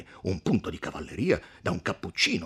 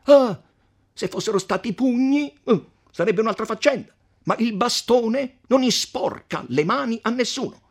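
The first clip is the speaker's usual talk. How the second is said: higher, indignant arguing; hot-headed count; 11 dB louder than usual. Acted. Count defending the beating to podestà, indignant. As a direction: argue high and scornful.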